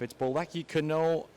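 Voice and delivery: usual speaking voice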